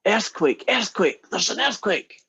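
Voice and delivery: high-pitched acted warning cry; high urgent mock call-out; mock alarm. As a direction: raise a pretend alarm, pitched well up. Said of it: "the English guy goes".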